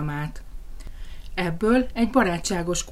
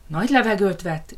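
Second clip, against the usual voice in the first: usual speaking voice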